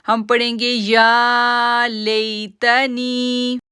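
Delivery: "या लेतनी" slow, "नी" held two seconds; stretched vowel. Shown as a demonstration. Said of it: "दो second के लिए खींचते हैं". ("नी" को दो सेकंड खींचो)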